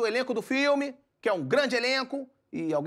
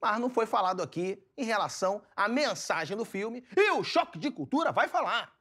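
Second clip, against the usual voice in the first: normal talking voice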